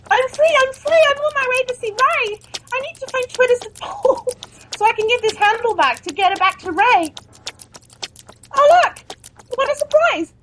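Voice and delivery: high, giddy melodrama; elated escaped assistant. Acted. Freed assistant off to phone Twitter support, elated. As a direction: exclaim each discovery with hammed-up delight.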